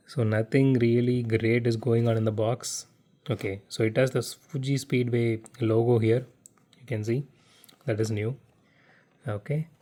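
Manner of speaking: usual speaking voice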